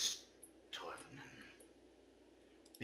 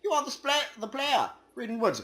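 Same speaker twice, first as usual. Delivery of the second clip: high, loud, eager cut-in; squeaky interruption; 14 dB louder, faster than usual. Acted. Cutting in on his partner in a squeaky voice.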